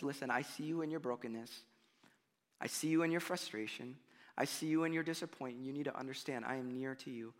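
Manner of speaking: soft, tender reassurance; loving Heavenly Father gently reassuring the brokenhearted; tender fatherly voice. Acted. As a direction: speak gently to someone broken. God speaking to the broken.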